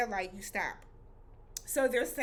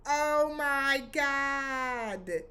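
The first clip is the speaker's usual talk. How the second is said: very slow, long-held "oh my God"; aghast, dragging the words out; drawn-out shock; 3 semitones higher, 4 dB louder, much slower than usual. Retold or imitated. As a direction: stretch every word out in disbelief. Shocked reaction to gunfire.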